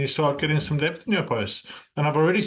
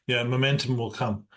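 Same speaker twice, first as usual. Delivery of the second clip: normal talking voice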